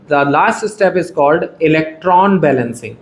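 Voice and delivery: very slow, word by word, for notes; slow dictating voice; slow dictation. Shown as a demonstration. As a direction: spell it out slowly so students can write. Teacher dictating the key term.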